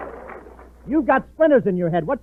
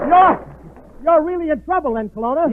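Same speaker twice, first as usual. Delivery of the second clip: alarmed; 8 semitones higher, 3 dB louder than usual. Acted. Alarmed at crash news.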